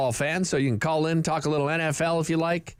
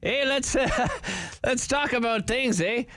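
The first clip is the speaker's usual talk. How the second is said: put-on Canadian accent; 5 semitones higher than usual. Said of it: "did I sound Canadian there or what?".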